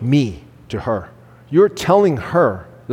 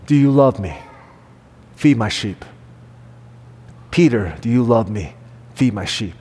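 tender Jesus; 5 semitones lower than usual. Acted. Jesus gently reinstating Peter.

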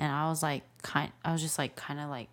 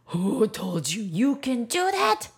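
high, loud, slow reprimand; mad scolding; 6 semitones higher, 5 dB louder, slower than usual. Retold or imitated. Dad retelling scolding his child, mad and drawn out. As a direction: draw it out in outrage. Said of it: "so mad".

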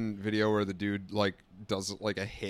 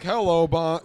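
mock border officer; 5 semitones higher, 11 dB louder, slower than usual. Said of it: "mispronounced bonjour".

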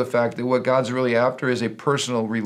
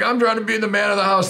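mock macho husband; 8 semitones higher than usual. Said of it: "men are like".

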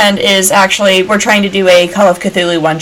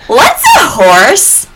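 ditzy Clariel; 10 semitones higher, 3 dB louder, slower than usual. Retold or imitated. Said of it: "the first thing that Clariel said".

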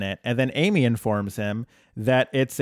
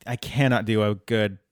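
ordinary talk

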